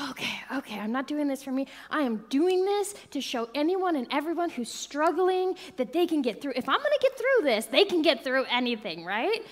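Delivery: high, loud pep talk to herself; rallying self-talk. Retold or imitated. Psyching herself up at the fire, loud and high. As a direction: psych yourself up to go. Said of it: "And I'm like".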